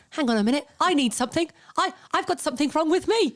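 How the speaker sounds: mock husband. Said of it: "he thinks".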